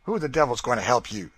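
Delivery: fast, loud scornful retort; scornfully. A narrator's reading. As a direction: sneer it out quickly. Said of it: "said Legree scornfully".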